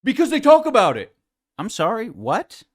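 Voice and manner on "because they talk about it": higher, louder, fast mocking parrot; mocking echo. Host parroting the podcaster's excuse, mocking. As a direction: repeat it back as a sneer.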